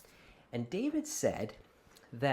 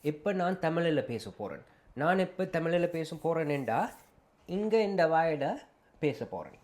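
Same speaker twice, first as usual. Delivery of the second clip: higher, louder, rapid Tamil; speaking Tamil; 5 semitones higher, 6 dB louder, much faster than usual. Shown as a demonstration. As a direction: switch fully into Tamil. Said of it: "I was speaking Tamil".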